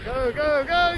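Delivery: high excited yelling; cheering. Real, not acted. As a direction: cheer them up the dune at full voice. Cheering car up the dune.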